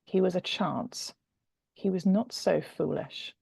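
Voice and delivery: ordinary delivery, nothing marked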